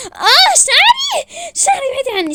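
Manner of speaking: high, drawn-out shriek; pained scream. Acted. Woman's hair is pulled by rival, screaming.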